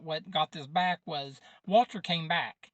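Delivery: usual speaking voice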